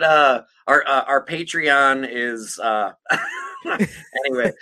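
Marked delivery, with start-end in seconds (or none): 3.0-3.9 shrieking laugh; real high-pitched cackle. Real, not acted. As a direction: crack up. Laughs mid-sentence.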